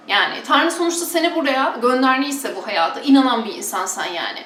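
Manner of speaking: ordinary talk, nothing marked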